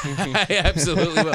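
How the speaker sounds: amused, laughing along at the host's joke. Real, not acted. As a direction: chuckle as you promise it.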